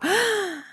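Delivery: quick high gasp up into the chest; mock the kids' big shallow inhale; sharp shallow gasp. Shown as a demonstration. Voice coach demoing children's shallow superhero breath, sharp gasp. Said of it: "they'll all go".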